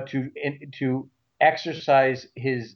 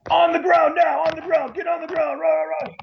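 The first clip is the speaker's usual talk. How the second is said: cop barking orders. Retold or imitated. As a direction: yell it like a cop with a taser at a head. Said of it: "puts it to my head three feet away and says".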